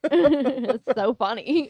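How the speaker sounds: fast, loud laughing delivery. Real, not acted. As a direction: laugh between the words. Laughing at the story.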